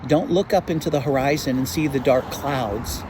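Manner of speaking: low, earnest closing words to the congregation. Acted. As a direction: settle the flock with calm warnings. Closing exhortation, lower and softer.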